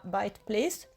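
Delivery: ordinary delivery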